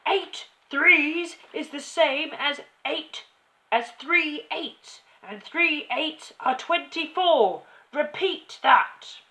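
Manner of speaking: slow, high, very loud drilling; emphatic bellowed lesson; shouting. A narrator's reading. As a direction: hammer it into the pupil word by word. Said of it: "the Trunchbull shouted".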